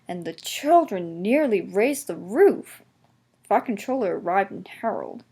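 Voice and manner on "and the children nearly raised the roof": plain reading voice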